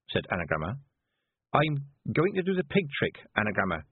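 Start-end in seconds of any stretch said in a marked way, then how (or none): 1.5-3.8 meek, slightly timid voice; mild, submissive reply. A narrator's reading. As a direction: answer the leader meekly. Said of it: "said Petulia meekly".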